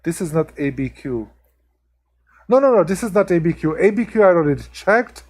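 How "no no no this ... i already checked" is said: higher, louder, fast insistent no no no; quick excited correction; emphatic denial. Real, not acted. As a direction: cut the guess off eagerly. Correcting chat's wrong guess.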